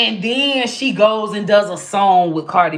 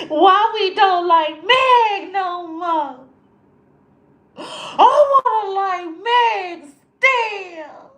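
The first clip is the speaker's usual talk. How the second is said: higher, slow whining sob through the words; mock crying fit; 7 semitones higher, slower than usual. A fan pretending to cry over Meg, higher slow sobbing whine. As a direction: whimper like a fan throwing a fit. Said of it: "Oh, I threw a fit".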